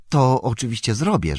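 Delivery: eager landlord agreeing; obliging innkeeper. A narrator's reading. Innkeeper agrees to keep the secret, obliging.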